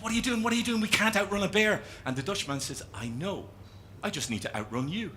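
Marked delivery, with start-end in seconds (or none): 0.0-1.8 panicky question. Acted. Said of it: "And the Irish guy says". 2.9-5.1 soft, unbothered deadpan; cool, laid-back punchline; super relaxed. Acted. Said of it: "The Dutchman's super relaxed".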